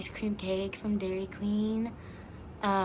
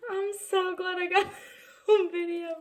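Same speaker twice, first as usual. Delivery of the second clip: higher, breathless laugh; laughing after a scare; 9 semitones higher, 3 dB louder than usual. Real, not acted. After the can pops, laughing with relief. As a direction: giggle out the relief.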